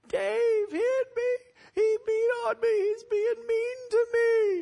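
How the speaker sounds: high wailing fake sob; fake crying. Little sister wailing to mom to get her brother in trouble, fake crying. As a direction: whine through tears like a tattling little sister. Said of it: "she starts crying and screaming".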